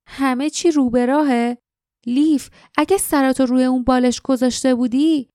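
indignant questioning, pitch lifted; incredulous echo. A narrator's reading. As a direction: throw the reassurance back in disbelief. Jasmine incredulously echoes his reassurance.

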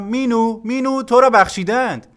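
high, loud, eager call; loud announcement. A narrator's reading. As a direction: call the good news up to your brother. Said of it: "با صدای بلند گفتم".

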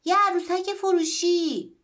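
loud, high, slow shout into the shop; shouting the sale into a grocery. Acted. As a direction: bellow it through the door. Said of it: "داد زد".

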